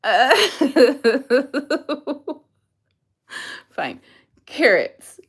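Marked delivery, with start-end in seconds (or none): none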